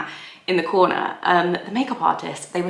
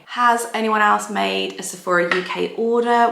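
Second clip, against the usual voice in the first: usual speaking voice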